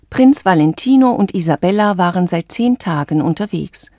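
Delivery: ordinary delivery, nothing marked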